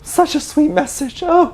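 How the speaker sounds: high, slow, squeaky gush; gushing squeal. Real, not acted. Fan gushing to viewers over [name]'s letter, high and squeaky. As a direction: melt over it in a tiny high voice.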